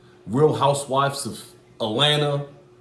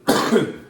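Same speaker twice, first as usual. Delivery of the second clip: coughing; 4 semitones higher, 4 dB louder than usual. Real, not acted. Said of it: "'cause I'm coughing and shit".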